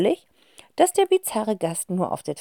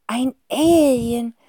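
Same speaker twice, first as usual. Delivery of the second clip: gasped; 5 semitones higher, 4 dB louder, much slower than usual. Acted. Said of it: "hört Cassandra ihren Bruder hinter sich japsen".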